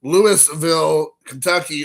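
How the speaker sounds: Louisville slowly as "LOO-iss-vill"; wrong pronunciation. Shown as a demonstration. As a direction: spell out the S in Louisville, slow. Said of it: "No one says".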